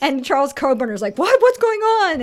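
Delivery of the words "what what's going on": loud, high-pitched bewilderment; mock startled. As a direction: act out an alarmed double take. Retold startled reaction to voice.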